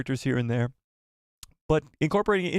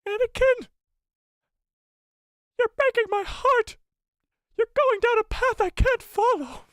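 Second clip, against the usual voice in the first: shrieking falsetto, far higher and louder; hammed-up Padme; 3 dB louder than usual. Streamer hams up Padme's plea to Anakin, high falsetto. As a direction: overwrought Padme wail. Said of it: "channel my inner Padme Amidala".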